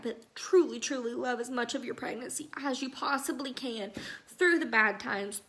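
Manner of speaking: usual speaking voice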